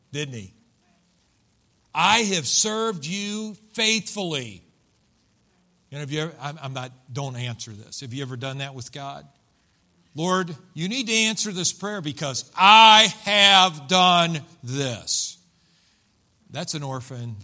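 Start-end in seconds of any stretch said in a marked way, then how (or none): 1.9-4.6 slow, raised, emphatic protest; self-righteous. Acted. Older brother brings up his work ethic, self-righteous. 10.2-15.4 loud, high, building to slow hammered words; demanding, entitled. Acted. Believer bargains with God in prayer, demanding.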